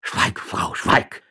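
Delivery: urgent, half-voiced hushing; flustered old man's stammered order; stuttering old magistrate. A narrator's reading. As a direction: hush her with a faltering, husky command. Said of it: "stotterte der Stadtrichter".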